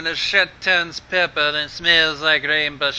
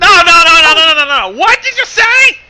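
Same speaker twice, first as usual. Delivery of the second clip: high, loud repeated no; disbelieving shriek; 14 semitones higher, 8 dB louder than usual. Real, not acted. Player shrieking at the King of the Hill joke. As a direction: cry out no over and over.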